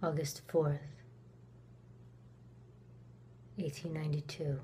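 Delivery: very soft, low and measured; low hushed recollection; hushed repeat. Acted. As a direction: let the date fade into a quiet echo. Date repeated as an echo.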